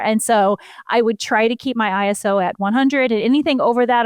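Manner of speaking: ordinary talk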